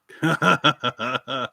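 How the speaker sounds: loud burst of laughter; a quick, loud run of chuckles. Real, not acted. Viewer guesses the wine, laughing out loud. Amused by own wine riddle being answered.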